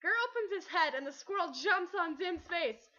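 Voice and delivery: plain reading voice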